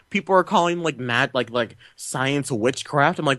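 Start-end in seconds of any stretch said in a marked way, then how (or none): none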